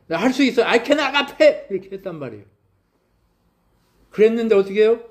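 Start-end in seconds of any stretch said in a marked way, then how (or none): 0.1-1.7 mock Peter boasting, high and loud; cocky Peter boast. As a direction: stretch out the brag like an overconfident kid. Peter boasts he can agape.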